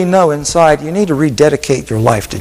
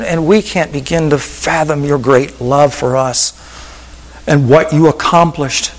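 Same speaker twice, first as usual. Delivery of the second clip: prayer. Acted. Pastor praying over the standing congregation, reverent and earnest. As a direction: pour it out reverently.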